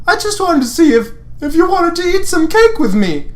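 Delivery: very high, jittery ask; nervous Lance. Acted. Lance nervously inviting the heroine for cake, high.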